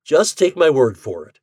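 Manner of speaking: mildly voiced cheerful suggestion; Ford voice. A narrator's reading. Ford insisting.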